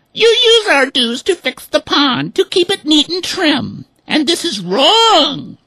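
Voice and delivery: very high, loud hen voice; furious hens. A narrator's reading. Hens protesting to the swimming club, high. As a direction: protest angrily. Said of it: "they said".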